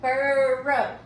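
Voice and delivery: "Burrow" loud, with one long er before row; drawn-out er. Shown as a demonstration. Teacher reminding pupils of one er sound, "Burrow" stretched out. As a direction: hold the er in "Burrow" as a single sound. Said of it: "count that er sound one time".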